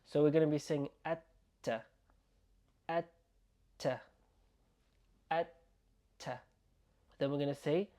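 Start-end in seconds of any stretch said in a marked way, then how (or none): 1.1-6.4 atta with the T doubled and held. Shown as a demonstration. Teacher showing learners the doubled letter in atta, held T. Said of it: "we're going to be doubling the letter".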